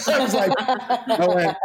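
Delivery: usual speaking voice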